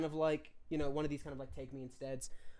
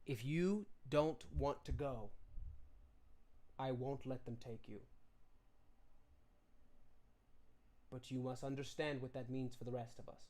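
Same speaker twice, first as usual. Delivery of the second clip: tender father; 5 semitones lower, 13 dB quieter than usual. Acted. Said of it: "he turns to you and he says".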